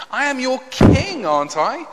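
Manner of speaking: high, loud burst of acted indignation; affronted appeal; indignant protest. Acts out David protesting to God.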